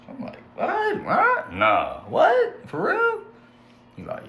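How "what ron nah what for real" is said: high squeaky stunned questions; shocked disbelief. Retold or imitated. Hearing Ron talked about him, high shocked squeak. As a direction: voice shoots up, can't believe it.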